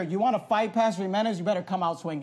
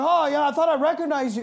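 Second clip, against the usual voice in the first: retold loud talk; 6 semitones higher, 4 dB louder than usual. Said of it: "And he's talking really loud".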